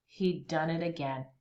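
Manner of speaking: plain reading voice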